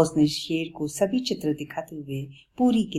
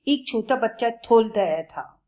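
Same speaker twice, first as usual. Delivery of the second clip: put-on higher, louder childish lisp; lion explaining innocently; 6 semitones higher, 4 dB louder than usual. Lion tells Bablu where the book came from, lisping.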